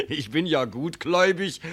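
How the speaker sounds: derisive laugh into a sneer; scornful laugh. Acted. Son-in-law mocking the father's claim, scornful laugh. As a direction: laugh off the lie.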